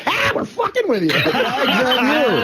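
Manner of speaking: slow, laughing gotcha; laughing reveal. Retold or imitated. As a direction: drag it out through a laugh. Goons reveal prank.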